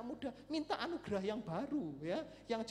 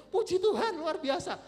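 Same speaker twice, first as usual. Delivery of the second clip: loud, high retold rejoicing; jubilant praise; 9 semitones higher, 12 dB louder than usual. Father hears of son's trip, loud jubilant praise. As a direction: burst out praising God.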